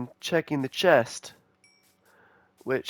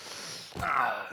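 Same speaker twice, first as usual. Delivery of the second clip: exasperated sigh; 6 dB quieter than usual. Real, not acted. Frustrated by rage bait.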